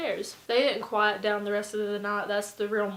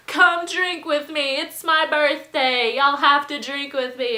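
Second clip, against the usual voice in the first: put-on pushy drunk pleading; 5 semitones higher, 9 dB louder than usual. Birthday host pressing visitors to drink, loudly pleading. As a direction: imitate an insistent birthday host.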